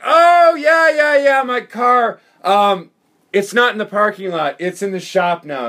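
blurting along with the lie; loud eager cover-up. Retold or imitated. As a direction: shout it like you just got the hint. Blind friend catching on, playing along.

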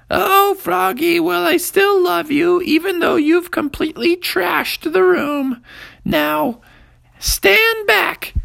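very high, loud mother voice; a mom forgiving her son, then taking charge; high, loud mother, slow at first; fond mom. A narrator's reading.

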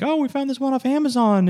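high mock parent voice; high mock voice of a parent bragging about an Amazon horn; naive parent. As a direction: imitate a clueless parent, chirpy. Parents quoted buying cheap horn.

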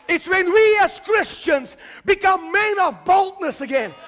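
loud, rising sermon climax; full-force preaching. Acted. Preacher declaring victory to the congregation, full-force preaching.

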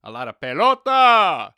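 hammed-up Spanish football commentator; Spanish announcer. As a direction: belt it like a Spanish play-by-play man. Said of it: "you get to hear stuff like".

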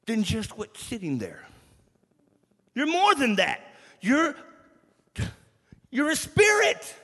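high, loud preaching climax; preaching at full force. Real, not acted. Preacher telling the youth they are spirit, loud and high. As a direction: lift the church up with force.

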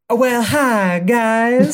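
a hammed-up bubbly English girl; English accent. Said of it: "I wanna embody this voice".

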